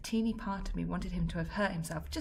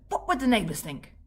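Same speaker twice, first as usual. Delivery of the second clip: Grams' line; 5 semitones higher, 8 dB louder than usual. Acted. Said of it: "something Grams would have said".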